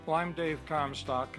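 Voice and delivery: ordinary delivery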